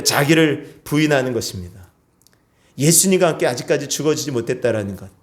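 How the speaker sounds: ordinary talk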